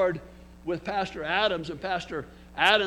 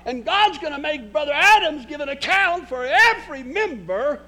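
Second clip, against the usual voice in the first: high, loud preaching that keeps climbing; full-force preaching; 7 semitones higher, 5 dB louder than usual. Acted. Pastor warning his church, preaching at full force. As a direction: preach it hard to the pews.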